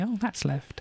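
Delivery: high pitched realisation; sudden realisation, voice up high; realising. Retold or imitated. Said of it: "that kind of thing to say".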